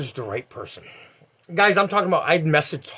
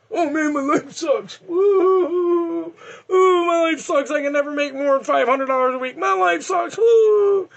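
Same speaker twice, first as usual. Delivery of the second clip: high, wailing self-pity with hammed-up sobs; mock crying whine; 12 semitones higher, 3 dB louder, slower than usual. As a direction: whine like a sad sack crying over his paycheck. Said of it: "So you fucking cry and then bitch".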